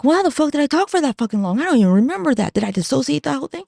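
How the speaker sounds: high-pitched baffled outburst; incredulous. Retold or imitated. Speaker baffled she talked for that long, incredulous. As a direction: quote yourself in mock disbelief. Baffled at her own recording length.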